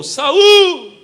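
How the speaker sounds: a huge shout of the name across the distance. Retold or imitated. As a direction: call out Saul from far away at full voice. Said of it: "Davi gritou". (grito forte chamando Saul)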